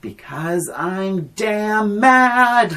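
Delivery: drawn-out, high-pitched mad screech; imitating a furious movie diva; mock scream. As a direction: act out a slow, high tantrum, hammed up. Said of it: "Why are you screaming".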